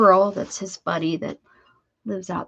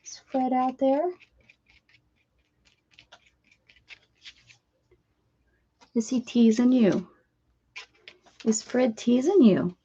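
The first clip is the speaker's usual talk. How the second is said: pet talk; 4 semitones higher than usual. Acted. Cooing at the dog.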